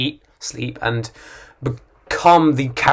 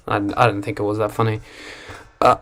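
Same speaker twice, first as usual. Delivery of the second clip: normal talking voice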